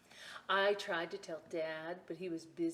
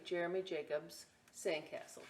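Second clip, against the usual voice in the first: usual speaking voice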